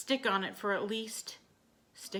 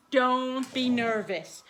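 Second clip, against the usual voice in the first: reassuring; 3 semitones higher, 7 dB louder, much slower than usual. Real, not acted. Soothing nervous dog.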